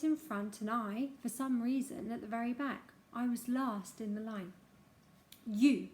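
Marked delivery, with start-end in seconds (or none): 5.5-5.9 a stern headmaster's sharp, louder call; single him out with one cold word; mildly voiced stern headmaster. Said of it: "Mister Coombs said".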